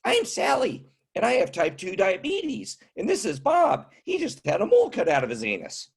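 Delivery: put-on high, chirpy old lady. Old woman introducing herself and her husband, high and chirpy. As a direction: cheerfully list ailments in a high old voice. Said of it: "This is how they really talk".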